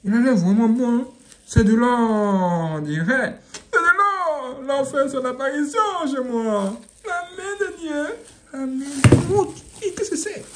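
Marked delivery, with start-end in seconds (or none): 3.7-6.8 awed. Acted. Marvels at the device. 7.0-10.4 mock awe.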